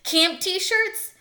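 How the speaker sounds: lightly knowing interruption. A narrator's reading. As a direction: show off what you know. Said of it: "interrupted Ralph knowledgeably".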